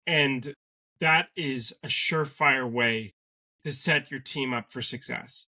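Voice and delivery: usual speaking voice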